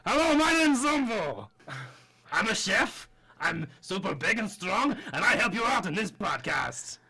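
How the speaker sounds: high put-on Italian accent, big and proud; Italian chef voice. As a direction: introduce yourself as a boastful Italian chef. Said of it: "Are you Italian?".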